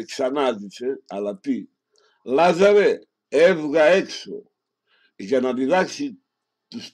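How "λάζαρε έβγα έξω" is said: called out loud and high, slowly; loud command. Acted. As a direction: summon the dead man out of the tomb. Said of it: "φώναξε με δυνατή φωνή".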